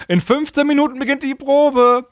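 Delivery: deutlich höher gesprochene Durchsage; Ansagestimme. Retold or imitated. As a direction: wie eine Lautsprecheransage vorlesen. Retold announcement before rehearsal. (Ansage zur Probe, gespielt als hohe Durchsagestimme)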